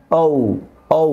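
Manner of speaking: ঔ with lips rounded like ওয়াও. Shown as a demonstration. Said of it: "দুই ঠোঁট গোল করতে হবে". (ঔ, ঠোঁট গোল করে)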